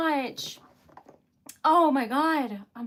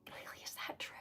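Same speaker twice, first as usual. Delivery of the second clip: tiny, stunned whisper; real hushed shock; disbelieving whisper; 22 dB quieter, faster than usual. Real, not acted. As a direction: whisper it as if it can't be true. Disbelief at the gift.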